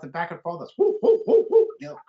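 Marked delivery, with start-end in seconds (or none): 0.8-1.7 loud, high, quick owl hooting; full-voiced imitation of excited owls; monkey owl hoots. Shown as a demonstration. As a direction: hoot like owls calling back and forth. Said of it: "two barred owls going back and forth".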